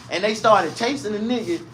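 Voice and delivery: normal talking voice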